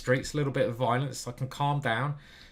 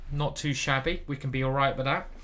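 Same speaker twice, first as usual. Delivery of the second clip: normal talking voice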